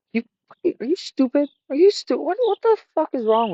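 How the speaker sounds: high-pitched mock exasperation; frustrated. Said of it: "I just get frustrated".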